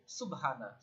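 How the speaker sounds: সুবহানা run together with no bounce on ব; casual unstretched সুবহানা, no echo after the b; without qalqalah. Shown as a demonstration. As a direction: say সুবহানা quickly, the b left unreleased and vowels short. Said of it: "কলকলা উচ্চারণ করতে পারলাম না".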